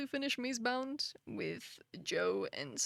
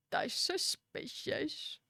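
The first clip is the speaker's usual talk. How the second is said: mock suspicious; 4 semitones higher, much slower than usual. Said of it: "I'm kidding".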